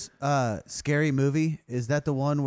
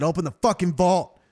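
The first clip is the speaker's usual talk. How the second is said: higher-pitched, forceful robber's order; insistent demand; 4 semitones higher, 4 dB louder than usual. Acted. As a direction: push the demand harder, acting impatient.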